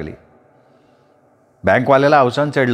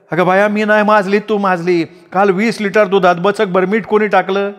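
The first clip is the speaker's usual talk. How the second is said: higher, shrilling woman's counter-accusation; Dhabi accusing; 7 semitones higher than usual. A narrator's reading. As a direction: throw it back at her. (आरोप करणारी ढबी)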